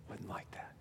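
reverent near-whisper; very soft inward voice; hushed whisper. Acted. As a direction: drop to a whisper, as if confiding. Hushed inner resolve about God.

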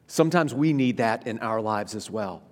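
ordinary talk

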